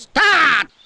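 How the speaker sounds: loud, high kick-off call; big drawn-out starting shout; shouted command. Acted. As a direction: bellow the cue to the band. Ordering the band to begin.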